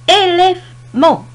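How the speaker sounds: "élément" slow and high, split into syllables. Shown as a demonstration. Teacher showing syllables to learners, "élément" slowly broken up. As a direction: stretch "élément" out, pausing before the last syllable. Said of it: "la première syllabe commence et se termine".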